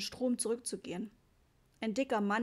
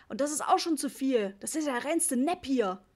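indignant dad ranting about a rip-off; worked-up, slightly louder protest; angry grumbling; 3 semitones higher, 5 dB louder than usual. A narrator's reading. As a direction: complain with a raised voice. Papa rants on about rip-off.

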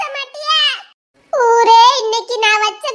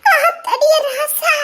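mock wail; 4 semitones higher, much slower than usual. Wife wails at husband's fantasy.

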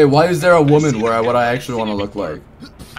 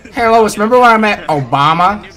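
higher cartoon dad voice; Peter Griffin voice; 6 semitones higher, 5 dB louder than usual. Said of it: "it's Peter Griffin".

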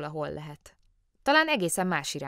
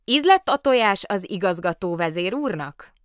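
loud, deliberately carrying question; raised voice; 3 semitones higher, 8 dB louder than usual. A narrator's reading. Bear pressing the silent director at table, loudly. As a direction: raise your voice at him. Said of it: "emelt hangon így folytatta".

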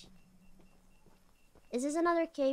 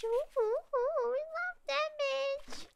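gushing; 10 semitones higher, 4 dB quieter, slower than usual. Real, not acted. Gushing over damage upgrade.

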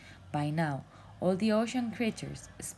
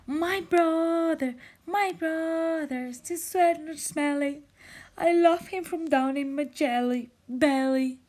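high, loud, slow heartfelt call; blasting call; 12 semitones higher, 7 dB louder than usual. Acted. Jellyfish calls out his love for his brother, loud and high. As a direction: call with all your heart. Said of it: "He blasted with all his jellyfish heart".

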